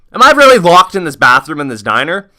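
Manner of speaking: can't believe this is happening; retold disbelief. Retold disbelief at being locked in.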